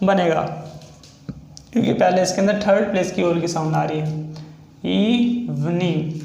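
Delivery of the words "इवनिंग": इवनिंग stretched out very slowly; slow sounding out. Shown as a demonstration. Shorthand teacher slowly sounding out इवनिंग for students. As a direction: drag इवनिंग so each sound is heard. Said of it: "ई का vowel".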